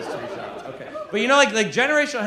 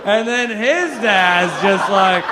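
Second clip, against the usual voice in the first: "his" stretched long with a swooping rise; drawn-out stress on HIS; 2 semitones higher, 5 dB louder, slower than usual. Real, not acted. Comic going back another generation of dads, "his" loudly stretched. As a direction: lean hard and loud on "his", dragging it out. Running bit, escalating lead-in.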